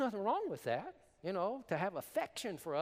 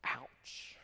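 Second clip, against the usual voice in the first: whispered ouch; 5 dB quieter than usual. Real, not acted. Reacts to his own harsh statement.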